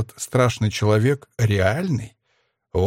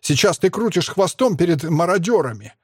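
angry shouting; 6 semitones higher than usual. A narrator's reading. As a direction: hurl the insults in heated outrage. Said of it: "кричу я". (гневная обличительная тирада)

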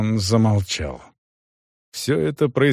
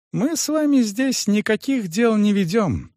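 calm denial; 11 semitones higher than usual. A narrator's reading. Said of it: "спокойно произнёс управляющий".

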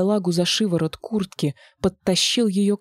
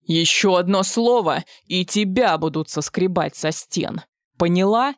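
menacing threat. A narrator's reading. Monster threatens Nastya.

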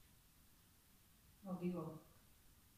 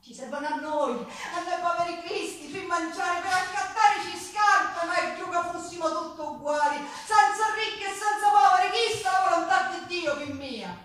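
sfogo; 5 semitones higher, 6 dB louder than usual. Acted. Defiant speech to priest.

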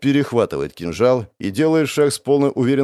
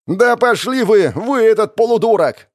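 howling defiance; 11 semitones higher, 6 dB louder than usual. A narrator's reading. Rebel cursing the boss, yelling. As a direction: scream the insult at full pitch. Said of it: "завопил он".

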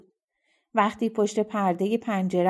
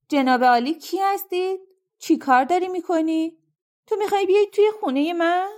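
much higher, louder, speeding up at the end; indignant challenge, rushing the last question; angry woman; 7 semitones higher, 3 dB louder than usual. Acted. Woman catching a stranger at her door, angry high rushed challenge.

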